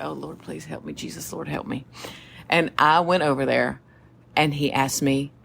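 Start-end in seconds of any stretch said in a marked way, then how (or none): 0.0-1.8 hushed, much softer desperate plea; silent prayer. Retold or imitated. Recruit praying in her head before facing the sergeant, hushed. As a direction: pray under your breath so no one hears.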